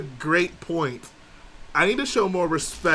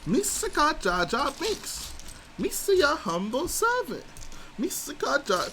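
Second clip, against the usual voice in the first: high goofy Gungan voice sung over the beat; squeaky high Jar Jar chant; Jar Jar sing-song; 12 semitones higher than usual. Acted. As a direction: sing it like a silly Jar Jar Binks. Jar Jar voice again.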